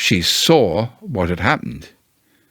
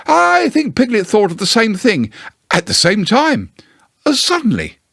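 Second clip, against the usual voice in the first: high, quick, hesitant bear voice; Pooh's voice; 11 semitones higher than usual. Acted. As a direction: Pooh covering for his friends, hurried and high. Pooh voiced in story.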